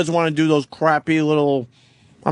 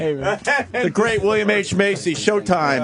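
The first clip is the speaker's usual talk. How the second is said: announcer voice; 4 semitones higher, much slower than usual. Shown as a demonstration. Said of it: "announcing the guest".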